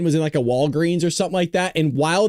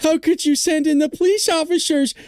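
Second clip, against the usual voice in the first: much higher, slow whiny outrage; mocking outraged liberal; 13 semitones higher, 3 dB louder than usual. Vlogger mimics Democrats complaining about troops, high whiny mock outrage. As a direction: whine it like a shocked liberal. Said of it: "Democrats like".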